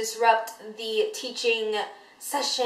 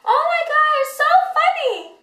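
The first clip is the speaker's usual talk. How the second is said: high, shrill-pitched fake giggle, much higher; mock giggling; 5 dB louder than usual. Vlogger mocking two giggling classmates in chemistry, high fake laughter. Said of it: "like, laughing".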